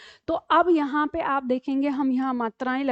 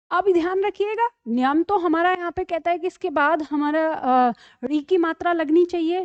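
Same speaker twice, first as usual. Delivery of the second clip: ordinary talk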